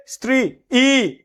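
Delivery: স্ত্রী ই with a high, detached "ee"; exaggerated two-beat "stri... ee"; separately pronounced vowel. Shown as a demonstration. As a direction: pitch up and sound the extra ই apart from স্ত্রী. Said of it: "ই কি আলাদা উচ্চারণ করবা?".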